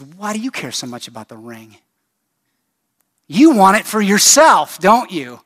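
acted outburst, pitch and volume jumping up; aggressive. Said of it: "Bilbo begins to get aggressive".

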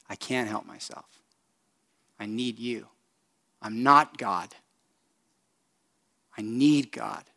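soft, quiet confession of need; humble surrender. Pretend. Preacher voicing a believer who gives up control, quiet and humble.

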